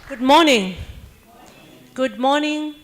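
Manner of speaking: normal talking voice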